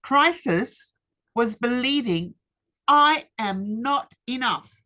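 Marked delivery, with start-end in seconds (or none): none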